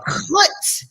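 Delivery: chutz with a rasped ch sound; throaty chutz. Shown as a demonstration. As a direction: rasp the ch in chutz. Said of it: "You gotta go chutz".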